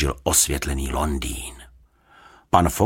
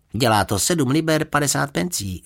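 lightly voiced usherette, higher pitch; usherette stating price; 8 semitones higher than usual. A narrator's reading. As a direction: announce the bill in a raised pitch. (uvaděčka oznamuje cenu)

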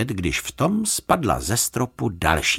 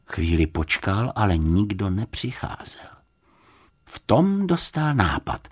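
usual speaking voice

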